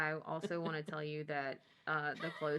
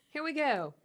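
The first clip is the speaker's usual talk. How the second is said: ordinary delivery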